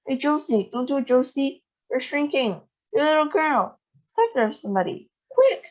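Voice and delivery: plead, then shout for help; panicked pleading. A narrator's reading. Grandpa pleading with his wife to stop shrinking.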